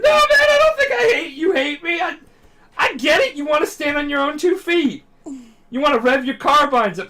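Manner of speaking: very high, loud, over-the-top weepy yelling; mock bawling. Crying friend backing his buddy's wish for independence, mock bawling. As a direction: wail your support at a friend, hammed up. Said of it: "and they're crying".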